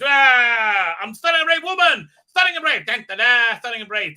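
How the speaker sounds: very high, loud mock yell; a hammed-up heroine losing it; snapping mock shout. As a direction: snap and bellow it, hammed-up. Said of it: "she snaps and goes".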